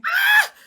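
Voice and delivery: high delighted shriek; excited scream. Real, not acted. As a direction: squeal with joy. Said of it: "the scream I scrumped".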